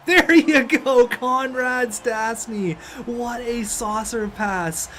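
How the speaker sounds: winning-goal roar; triumphant goal call. Real, not acted. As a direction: draw out the cheer for the winner.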